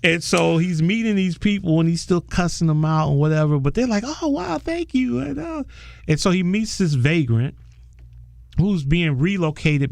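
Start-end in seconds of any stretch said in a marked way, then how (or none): 4.1-5.6 high, airy mock awe as the impressed crowd; impressed gush. People charmed by his French, high mock awe. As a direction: gush like someone dazzled by fancy talk.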